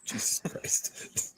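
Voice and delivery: breathy, slow whisper; whispered aside. Real, not acted. Host reacting to the joke magnet in a slow whisper. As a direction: breathe it out in disbelief.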